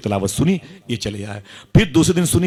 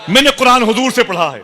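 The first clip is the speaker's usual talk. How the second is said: rapturous cry; 11 semitones higher, 4 dB louder than usual. Real, not acted. Preacher repeats line in rapture.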